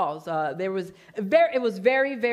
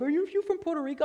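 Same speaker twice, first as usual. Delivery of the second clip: quoting curious people; 7 semitones higher, faster than usual. Retold or imitated. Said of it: "people are like".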